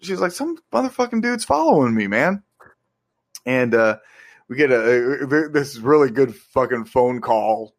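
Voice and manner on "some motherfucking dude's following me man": high, mock-panicked girl voice; freaked out. Retelling Lori spooked by a stalker, high mock panic. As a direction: squeak it out like a spooked teen. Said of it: "she's freaking out".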